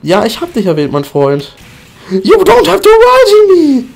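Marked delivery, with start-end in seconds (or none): 2.1-3.8 higher, slower put-on whine; high mock voice. Streamer answering chat in a high mock whine.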